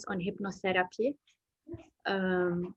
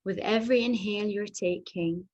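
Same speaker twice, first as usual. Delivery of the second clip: hypnotherapy voice. Acted. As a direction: lull them into calm, drifting into whispers. Leads a hypnotherapy relaxation.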